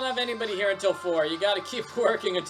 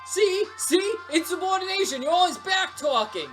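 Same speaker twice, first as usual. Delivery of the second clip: high, agitated boss scolding; worked-up, high-pitched reprimand; triumphant accusation; 9 semitones higher, 4 dB louder than usual. Acted. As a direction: pounce on the employee's back-talk. Boss seizes on back-talk.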